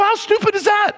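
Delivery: a listener squeaking in outrage at the servant; mock disbelief. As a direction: play a scandalized onlooker in a shrill high squeak. Listener mocking the ungrateful servant.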